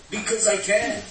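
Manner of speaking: high, smug boast; the ruthless boasting to the weak; bully's motto. Pretend.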